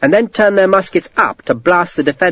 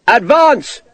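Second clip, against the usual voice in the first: officer ordering the advance; shouted command; 9 semitones higher than usual. A narrator's reading. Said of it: "Sharp shouted".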